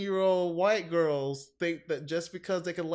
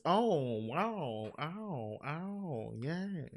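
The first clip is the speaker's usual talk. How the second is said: Mae West impression; much slower than usual. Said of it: "And she says".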